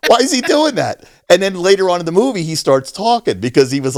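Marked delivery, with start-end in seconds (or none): 0.1-1.0 high-pitched incredulous question; mock bafflement. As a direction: ask it high, baffled and amused. Reacting to random "ack", amused.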